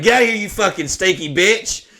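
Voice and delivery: high, loud pretend outburst; mock yell. Man imagining pushing the woman off him, mock yelling. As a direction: yell it as a hammed-up shove-off.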